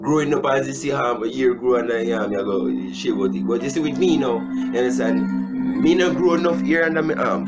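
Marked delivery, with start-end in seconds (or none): none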